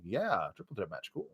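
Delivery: low, quiet, unimpressed yeah; deadpan unimpressed. Retold or imitated. The fan shrugs off the announced match, low and quiet. His own unimpressed reaction.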